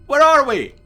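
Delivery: high, loud, urgent question; frantic demand. Acted. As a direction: shout it in dismay. Frantic after being pulled out.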